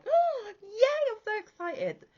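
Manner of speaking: imitating overjoyed coworkers with a squeal; a high, gushing thank-you; mock excited. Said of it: "but they were like".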